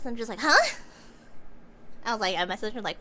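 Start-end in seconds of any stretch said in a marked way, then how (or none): 0.4-0.8 sudden loud high huh; shocked huh. Retold or imitated. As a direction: blurt out in disbelief. Reacting to random donation.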